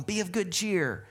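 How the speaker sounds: high, chipper retold encouragement; mock cheerfulness. Jesus answering unhappy disciples, in high mock cheer. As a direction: pitch it up in playful mock cheer. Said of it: "And he's going".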